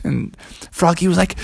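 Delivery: usual speaking voice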